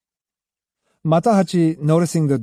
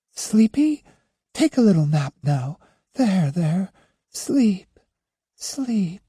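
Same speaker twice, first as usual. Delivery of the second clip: soothing mother; 6 semitones higher, slower than usual. Acted. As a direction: coax the baby to sleep, gently. Mother soothing crying baby.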